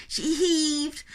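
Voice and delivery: ordinary delivery, nothing marked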